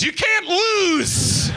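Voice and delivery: high, slow, shouted sales pitch; sarcastic mock hype; mock salesman hype. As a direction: stretch it out like a huckster closing a deal.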